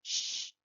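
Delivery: loud unvoiced Sh, as if hushing someone; shushing. Shown as a demonstration. Profesora hace Sh para mandar callar. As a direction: hush someone with Sh. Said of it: "para que una persona se calle".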